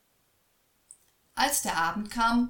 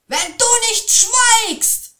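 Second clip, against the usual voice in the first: much higher, loud, slow yell; boy shouts down the troll, much higher and louder; 14 dB louder, slower than usual. A narrator's reading. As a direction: scream the boy's bluff at the troll. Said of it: "schrie er den Troll an".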